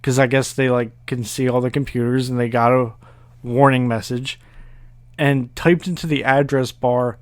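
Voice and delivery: ordinary talk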